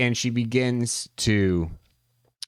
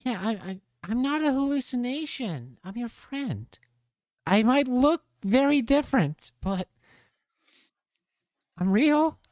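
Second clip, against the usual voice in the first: a gentle creature, much higher; 12 semitones higher than usual. Acted. A creature reassures the professor.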